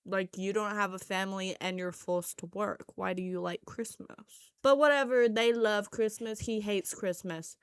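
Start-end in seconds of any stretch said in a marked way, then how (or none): none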